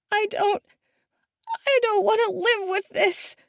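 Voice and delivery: very high, breaking despair. A narrator's reading. Daughter on the cliff edge, high and despairing. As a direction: voice cracking on the edge.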